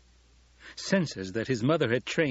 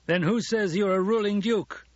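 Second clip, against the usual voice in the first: high, raised, defiant challenge; demanding; 10 semitones higher, 4 dB louder than usual. A narrator's reading. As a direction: throw the question back as a challenge. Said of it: "the man demanded".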